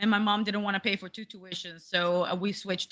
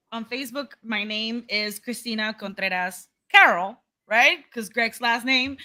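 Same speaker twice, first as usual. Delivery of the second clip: normal talking voice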